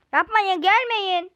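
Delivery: shouted don't come; loud desperate plea. Acted. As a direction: yell to hold them back. Game character pleading.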